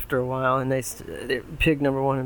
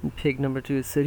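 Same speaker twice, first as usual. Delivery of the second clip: plain reading voice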